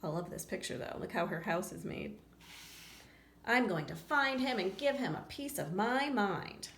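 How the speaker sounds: plain reading voice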